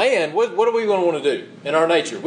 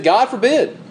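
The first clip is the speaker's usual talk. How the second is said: emphatic denial; 6 semitones higher than usual. Acted. Quoting Paul's strong denial.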